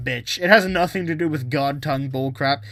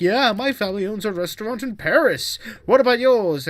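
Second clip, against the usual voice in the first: higher, louder mock snob; snobby rich guy; 5 semitones higher, 5 dB louder than usual. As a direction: boast like a snobby rich student. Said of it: "rich guy who was snobby".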